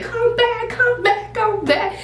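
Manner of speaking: high repeated frantic calls; repeated high yells, fading slightly; screaming. Retold or imitated. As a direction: call her back in a panic. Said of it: "we had to scream".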